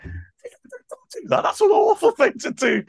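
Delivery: very high mock-stern self-telling-off; mock scolding. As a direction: scold yourself in a high silly voice. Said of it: "I was like".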